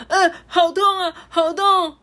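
high, loud cry of pain; ouch, it hurts, high and loud. Acted. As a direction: yelp as if pecked. Hanji pecked by goose.

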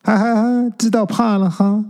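put-on high, laughing tease of a ghost girl; gleeful laugh. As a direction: cackle at the scared boy, then tease. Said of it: "开心极了". (高声得意地笑着取笑)

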